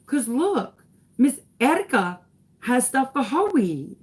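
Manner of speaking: put-on child's voice. Son explaining to mom about his teacher, lightly imitated child. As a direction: imitate an innocent little boy pointing something out. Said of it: "And he goes".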